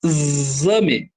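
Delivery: "exame" with a long, held z sound; correct pronunciation demo. Shown as a demonstration. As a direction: say "exame" as "e-zzzame". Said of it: "Não, eu falo".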